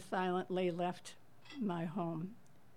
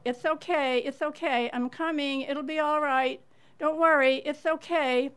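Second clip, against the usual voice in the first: higher, louder calling, soothing from afar; calling out reassurance; 6 semitones higher, 7 dB louder than usual. Retold or imitated. Neighbor calling to crying strangers in the dark, loud reassurance. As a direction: call out it's okay to calm strangers. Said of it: "I called out".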